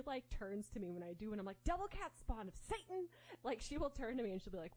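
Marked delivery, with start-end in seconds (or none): 1.6-3.1 high-pitched scolding cry at the cat; playful high yell at a pet; mock yell. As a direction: call it out high, mock-damning. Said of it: "I yell spawn of Satan at her".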